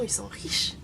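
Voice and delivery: whispered like a secret; mock whisper. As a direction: drop to a whisper for the juicy part. Acted asker, gossipy aside. (chuchoté comme un ragot)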